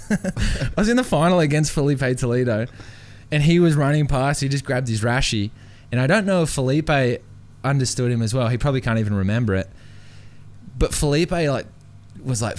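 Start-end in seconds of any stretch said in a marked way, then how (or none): none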